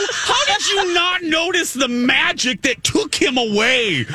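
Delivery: high-pitched mock outrage; incredulous re-enacted yell at the TV; retold scream. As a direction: yell it in exasperated disbelief. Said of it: "I screamed at the T V at that moment".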